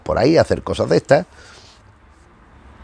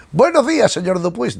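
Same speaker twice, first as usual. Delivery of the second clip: much higher, chirpy sleep-talk greeting; sleep-talking Lampil; 10 semitones higher than usual. Retold or imitated. Lampil talks in his sleep to his sleepless wife, a high chirpy greeting. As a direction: pipe up brightly from a dream, half asleep.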